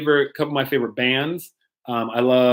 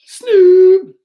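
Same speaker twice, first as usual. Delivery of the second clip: a high, loud call-out; shouted name; 10 dB louder than usual. Pretend. Pastor hyping a viewer called Snoop Dogg, loud call. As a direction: yell the name like a hype man.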